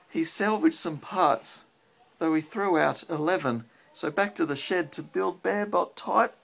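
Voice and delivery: rhythmic storybook read-aloud; reading to a child. Acted. Parent reads rhyming robot book to child, lilting read-aloud. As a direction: read the rhyme to a little one at bedtime.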